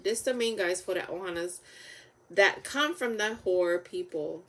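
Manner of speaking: ordinary delivery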